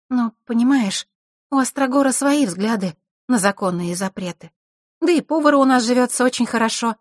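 put-on lively girl. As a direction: explain it eagerly as the young hostess.

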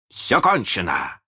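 exclaiming. A narrator's reading. Hook tells his pirates all is over, exclaiming. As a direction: cry it out slowly as the pirate captain. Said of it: "воскликнул Крюк".

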